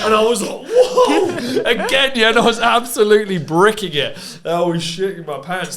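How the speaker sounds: loud, high laughing retelling; laughing at his own panic. Real, not acted. Laughing loudly while telling the hosts how scared he was.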